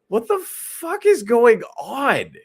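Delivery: loud, high, drawn-out disbelief; loudly flabbergasted; aghast disbelief. Real, not acted. Host reacting aghast to the news.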